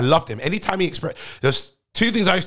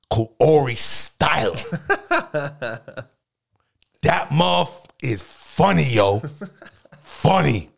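put-on New York accent; slower than usual. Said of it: "What am I even doing?".

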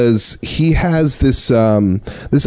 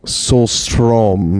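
light Swedish accent on the name; little Swedish accent; 3 semitones lower than usual. Shown as a demonstration. Showing the band name's pronunciation, light Swedish accent. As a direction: say it with a gentle Swedish lilt. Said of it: "that was like a little Swedish accent".

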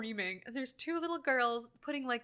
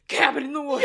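very loud, higher mock announcement; sudden title blast; 4 semitones higher, 11 dB louder than usual. Podcaster imitates title crashing into a dull chat, sudden shout. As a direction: blast it out like a jump scare.

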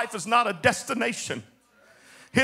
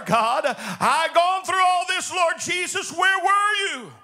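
shouted, pitch raised lament to God; angry prayer. Acted. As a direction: hurl the questions at God.

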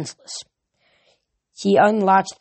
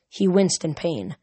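ordinary delivery, nothing marked

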